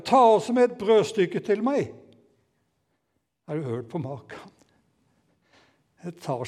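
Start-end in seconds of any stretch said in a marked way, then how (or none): 0.0-1.9 loud, high shout after her; called-out demand. Acted. Elia shouting after the widow for bread, loud and high. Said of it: "Så roper han igjen etter henne".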